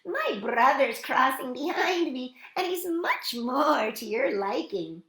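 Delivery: high, loud tiny-goat plea; high storybook kid goat; squealing little goat. A narrator's reading. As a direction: bargain eagerly in a small high voice. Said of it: "squealed the little youngest billy goat Gruff".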